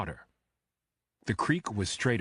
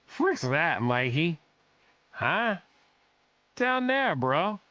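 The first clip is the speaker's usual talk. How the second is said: put-on higher, slurred drunk bro voice; drunken man; 8 semitones higher, slower than usual. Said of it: "a man's drunken voice said".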